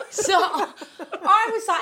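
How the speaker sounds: laughing. Real, not acted. Laughing while retelling.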